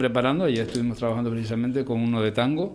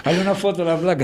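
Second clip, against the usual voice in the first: laughing; 6 semitones higher, 7 dB louder, faster than usual. Real, not acted. Guest jokes about name plate.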